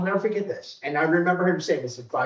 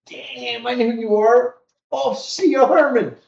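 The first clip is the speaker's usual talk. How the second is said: higher, louder retold burst of recognition; imitated excited greeting; 6 semitones higher, 4 dB louder than usual. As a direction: act out spotting someone you know, building loud. Ex-inmate recognizes him, retold.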